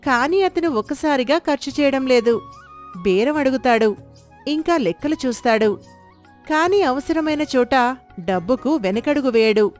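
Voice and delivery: usual speaking voice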